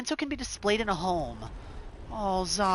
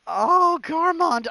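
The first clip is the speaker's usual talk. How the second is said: high, loud cry of "Garmond"; mock grief; 11 semitones higher, 5 dB louder than usual. Player mourning the fallen knight, high loud cry. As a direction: call out his name in theatrical sorrow.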